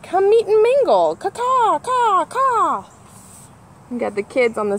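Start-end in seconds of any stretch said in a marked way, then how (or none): 0.0-2.8 much higher, louder cawing call; crow call. Acted. The crow calling the kids, a loud cawing. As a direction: caw it out like a crow. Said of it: "Crow calls out".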